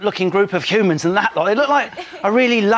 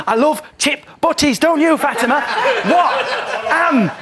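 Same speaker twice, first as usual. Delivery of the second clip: higher, slow, drawn-out greedy voice with laughs; mock Dougie; 6 semitones higher, slower than usual. Mocking Dougie craving chip butties, higher and laughing. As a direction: ham up a food-mad child.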